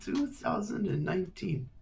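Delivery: high, airy voice of a haughty lord; lordly old nobleman. Acted. Lord addressing the girl, high and haughty. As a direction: play the lord talking down to a child.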